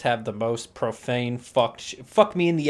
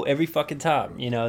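ordinary delivery

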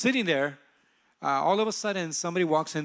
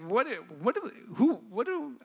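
high, stumbling confusion; lost for words, reaching higher; bewildered; 7 semitones higher than usual. Retold or imitated. Overwhelmed, can't understand love.

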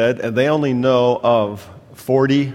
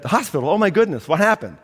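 alarmed grandma; 7 semitones higher than usual. Retold or imitated. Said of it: "His mother said".

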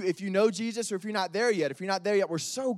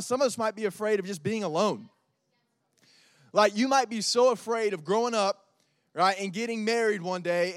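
usual speaking voice

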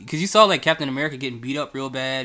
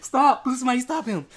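high mock-desperate plea, laughing through it; retold pleading; 11 semitones higher, 4 dB louder than usual. Moviegoer begging for Captain America's rescue, laughing high plea. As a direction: imitate begging someone to step in. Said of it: "first time I ever made this noise".

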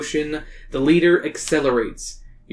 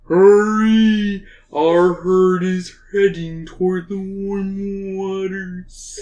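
whale voice; 3 semitones higher, much slower than usual. Acted. Narrator voices whale calling.

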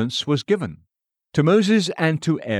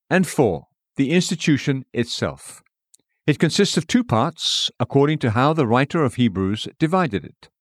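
ordinary talk, nothing marked

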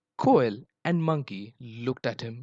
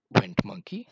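plain reading voice

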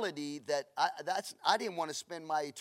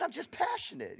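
mock innocent; 11 semitones higher, 3 dB quieter than usual. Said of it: "Man, you seem mad sometimes".

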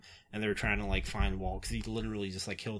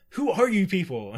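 higher, louder imitating a stunned father; mock bewildered Michael; 9 semitones higher, 7 dB louder than usual. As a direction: throw it out in hammed-up disbelief. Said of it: "and Michael's like".

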